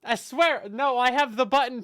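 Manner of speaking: loud, high alarmed outburst; panicked surprise. Real, not acted. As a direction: blurt it out in alarm. Realizes mic stayed muted.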